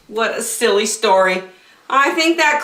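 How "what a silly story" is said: ordinary delivery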